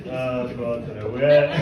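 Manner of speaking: normal talking voice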